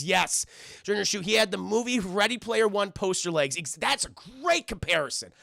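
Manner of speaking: normal talking voice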